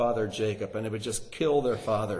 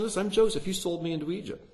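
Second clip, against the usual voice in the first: excited; 7 semitones higher, faster than usual. A narrator's reading. Joseph voiced revealing himself.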